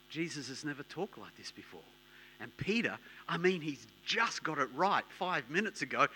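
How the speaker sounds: ordinary talk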